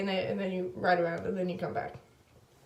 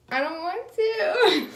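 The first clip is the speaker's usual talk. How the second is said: laughing whine; 9 semitones higher, 3 dB louder, slower than usual. Retold or imitated. Refusing boyfriend, laughing.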